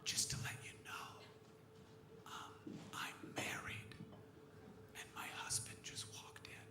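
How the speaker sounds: soft unvoiced whisper, slowing on married. Woman at zoo confessing to him, whispering. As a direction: lean in and breathe it confidentially. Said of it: "she went".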